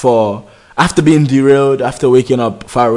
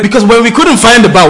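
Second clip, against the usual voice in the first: excited; 8 semitones higher, 6 dB louder than usual. Retold or imitated. Retelling shouting for help.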